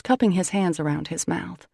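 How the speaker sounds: usual speaking voice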